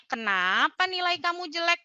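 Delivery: high, sharp "Kenapa"; cornering question. Shown as a demonstration. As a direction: ask like an accusation. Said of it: "Kita kayak langsung di sod".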